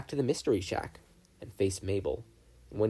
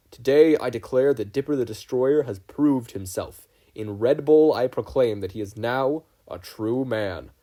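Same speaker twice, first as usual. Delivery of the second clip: proclaiming chieftain; 2 semitones higher, 8 dB louder than usual. Acted. Leader proclaims Dipper a man, loud and ceremonial. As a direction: declare it to the whole tribe.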